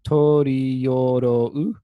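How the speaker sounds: stretched-out recitation, strong at the start; syllable-by-syllable demo. Shown as a demonstration. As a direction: draw out every syllable for the count. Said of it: "let's take the next line as well".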